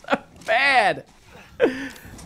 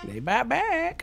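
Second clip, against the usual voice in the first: mock echo; 6 semitones higher, 4 dB quieter, much slower than usual. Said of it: "repeats villain line".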